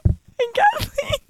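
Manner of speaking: high, helpless laughter breaking up the words; laughing too hard. Real, not acted. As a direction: try to tell it through real laughter. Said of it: "I can't even get it out".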